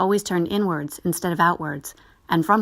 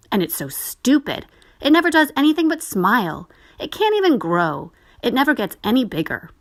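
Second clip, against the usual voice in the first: put-on higher, louder jeering boy; scornful boy; 5 semitones higher, 4 dB louder than usual. A narrator's reading. Boy jeers at the doll, louder. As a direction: run the doll down.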